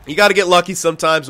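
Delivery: ordinary talk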